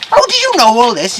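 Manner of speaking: high, loud disbelief; astonished question. Acted. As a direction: demand it in amazement. Farmer astonished by blacksmith.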